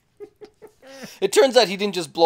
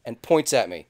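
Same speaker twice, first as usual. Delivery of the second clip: ordinary talk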